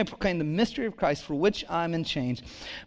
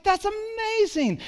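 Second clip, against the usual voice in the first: much higher falsetto lady, drawn out; gushing lady; slower than usual. Retold or imitated. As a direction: gush like a delighted older lady. Lady at estate sale voiced.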